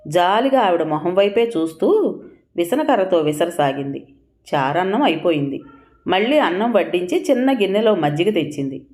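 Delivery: ordinary delivery, nothing marked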